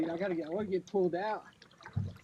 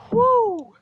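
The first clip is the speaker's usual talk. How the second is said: high, loud whoop; 12 semitones higher, 8 dB louder than usual. Real, not acted. Angler whooping at the catfish, high and loud. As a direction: let out a joyful woo. Celebrating the catch.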